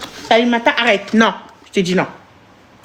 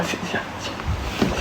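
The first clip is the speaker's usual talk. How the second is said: whispered coaxing; 13 dB quieter than usual. Real, not acted. Mother coaxing her child.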